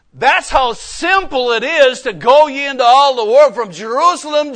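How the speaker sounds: loud, high, driving sermon cry; preaching at full force. Acted. Pastor preaching missions at full force.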